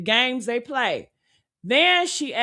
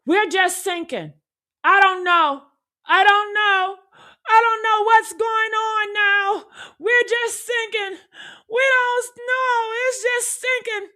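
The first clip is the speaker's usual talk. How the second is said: higher, offhand mock of a caller who shrugs about sinking; flat nonchalant caller; 9 semitones higher than usual. Mocking the caller's calm about sinking, offhand and high. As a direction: say it like the Wi-Fi went out. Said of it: "Say it with that same energy as somebody telling you the Wi-Fi went out".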